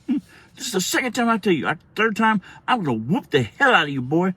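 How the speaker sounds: imitated mom's high-pitched warning; threatening mom. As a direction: scold like a mother at her limit. Mother threatening son, retold.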